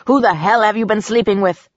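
jealous snarl, understated. A narrator's reading. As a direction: demand answers with a touch of fury. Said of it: "he snarled".

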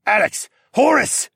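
high, loud commanding call; yelled names. A narrator's reading. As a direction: yell for two fighters across a battle. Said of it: "Regent Siskern yelled".

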